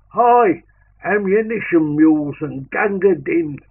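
high, very loud battle call; front file shout. Acted. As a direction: yell for ammunition over the gunfire. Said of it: "you could hear the front file shout".

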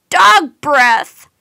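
loud disgusted outburst; disgusted shout. A narrator's reading. Mike shoved down by a dog, loud disgusted outburst. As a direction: spit it out in disgust.

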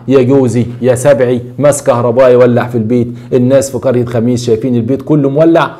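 usual speaking voice

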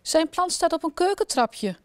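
high indignant complaint; queen accusing the king, high and cross; angry. A narrator's reading. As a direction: point it out, still furious. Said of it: "roept de koningin boos".

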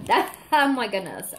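giggling through the words; laugh, lost for words; overwhelmed laugh. Real, not acted. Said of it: "I'm getting a little overwhelmed".

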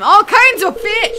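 high, loud, stretched-out announcement; drawn-out showy holler; band-name shout. Pretend. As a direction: announce it like a band taking the stage. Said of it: "Which is my new band name".

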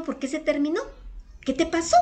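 high-pitched, urgent questioning; acting out a worried friend's startled questions; alarmed concern. Asking a crying friend what happened, alarmed.

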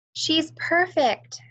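slow, high and tender; high, slow gushing mother; adoring mother. A narrator's reading. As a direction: coo over the new baby.

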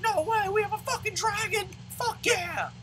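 very high, excited squeal; mock amazement. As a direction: squeal like an overexcited fan, putting it on. Acts out amazed reaction to dragon.

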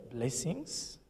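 ordinary talk, nothing marked

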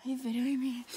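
normal talking voice

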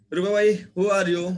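put-on loud, slow call of an old woman; Rupabai calling out. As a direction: call out like a suspicious villager. Rupabai voiced in story.

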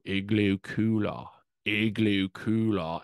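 slow, drawn-out Australian vowels; stretched-out mock Australian, "cooler" ending in -ah; Australian accent. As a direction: imitate someone practicing an Australian accent. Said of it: "an Australian accent".

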